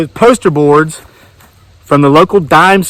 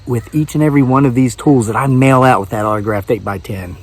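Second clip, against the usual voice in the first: normal talking voice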